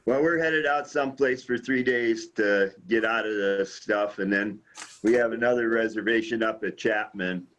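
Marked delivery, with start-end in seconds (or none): none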